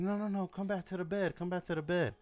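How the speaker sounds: retold woman's urgent plea; imitated coaxing. She coaxes him back to bed.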